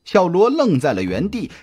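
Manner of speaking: plain reading voice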